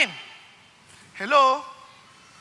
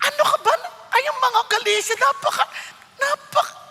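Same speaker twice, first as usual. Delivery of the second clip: exasperated scolding; 8 semitones higher than usual. Retold or imitated. Paul scolding Galatians, exasperated.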